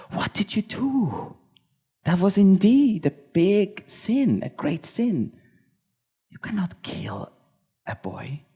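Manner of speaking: high, shocked cry that sinks to a hush; aghast, pitch up, then fading; appalled outburst trailing off; shocked protest. Retold or imitated. Moses objects to the killing.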